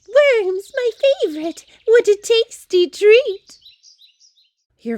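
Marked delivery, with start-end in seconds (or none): 0.1-3.6 much higher, louder bird chirp; chirpy bird. Acted. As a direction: cheep it like a tiny bird. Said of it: "You could twitter and cheep, you'd chirp and tweet".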